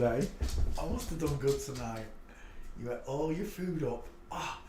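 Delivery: ordinary delivery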